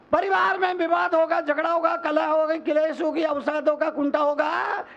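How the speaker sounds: high, rousing run of warnings; rapid-fire list. Real, not acted. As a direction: rattle them off high without a breath. Rattles off the consequences. (ऊँचे सुर में एक साँस में गिनाते हुए)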